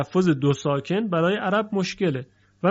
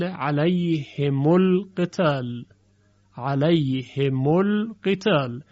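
علیهم القتال drawn out slowly; deliberate, slow recital of the phrase; slow example; much slower than usual. Shown as a demonstration. Teacher presents the example slowly. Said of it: "میم ساکن بعدش الف و لام بیاد".

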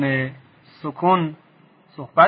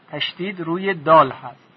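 ordinary talk, nothing marked

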